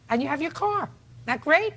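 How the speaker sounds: mock cheer to scold him; sarcastic scolding tv judge. Real, not acted. Judge mocking plaintiff's complaint.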